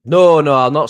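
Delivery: high, loud "no, no" of protest. Real, not acted. Protesting to friends who tease him, loud and high. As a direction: cry out against the joke.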